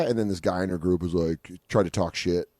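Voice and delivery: usual speaking voice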